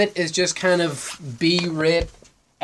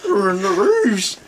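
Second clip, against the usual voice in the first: cartoon panic; 2 semitones higher, slower than usual. Parody of the trailer's panic.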